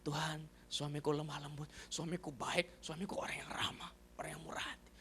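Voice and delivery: hushed, low, humble prayer; wife's overheard prayer. Retold or imitated. Husband imitating his wife's overheard prayer, hushed. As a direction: murmur it softly to God. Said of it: "saya dengar dia berdoa".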